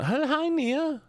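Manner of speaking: very high drawn hi; squeaky high greeting. Acted. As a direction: pitch the hi way up.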